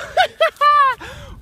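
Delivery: breathless laugh from the cold; shocked laugh. Real, not acted. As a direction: laugh through the icy shock. After the ice water.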